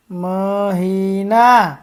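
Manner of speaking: મહિના stretched long, every syllable held. Shown as a demonstration. First slow reading of the word list.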